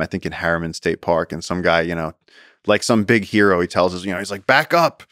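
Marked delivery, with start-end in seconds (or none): 4.5-5.0 mock tough guy. Show-off hiker orders everyone back from the copperhead, higher mock bravado. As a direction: play the show-off about to fight the snake. Macho hiker warning off others.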